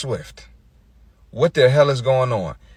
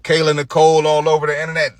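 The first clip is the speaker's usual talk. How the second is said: ordinary delivery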